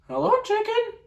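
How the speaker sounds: very high squeaky duck. Acted. Ruben as Duck greeting Dog, squeaky high. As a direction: chirp it out. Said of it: "we have to use the voices".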